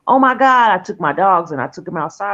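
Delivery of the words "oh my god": high, loud shocked cry; the jolt of seeing the room full of smoke; shocked gasp. Retold or imitated. Waking to a smoke-filled living room, high loud shocked cry. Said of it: "I'm like".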